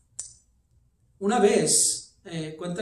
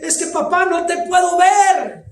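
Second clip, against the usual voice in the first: frightened son; 11 semitones higher, 11 dB louder than usual. Retold or imitated. Son calls through the smoke to his father, wailing. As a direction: cry out that you can't see him. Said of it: "Y dice".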